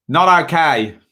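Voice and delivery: clipped Kiwi "Not okay", stress on not; New Zealand accent. Retold or imitated. Imitating old boss scolding badly behaved kids, New Zealand accent. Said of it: "as my old boss used to say in a New Zealand accent".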